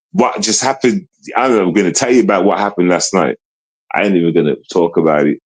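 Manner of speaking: ordinary talk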